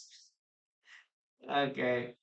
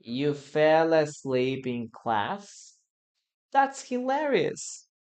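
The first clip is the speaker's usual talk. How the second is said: dictation pace; slower than usual. Shown as a demonstration. Teacher dictating the sentence for learners to write. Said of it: "Listen and write".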